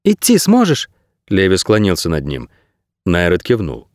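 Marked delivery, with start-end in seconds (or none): 0.0-0.9 put-on high-pitched young boy; boy's voice. A narrator's reading. Boy leaning over the injured man, high boyish voice. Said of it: "Мальчик протянул руку".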